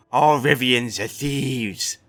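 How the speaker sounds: slow, loud, sneering tavern brute; loud, slow drunk bully in a tavern; pockmarked thug. A narrator's reading. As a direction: lean on each word like a drunk bully. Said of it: "the pockmarked man went on".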